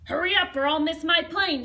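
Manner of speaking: loud, hurried call to the driver; impatient shout. Acted. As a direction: urge the driver on, almost yelling. Woman late for plane in taxi.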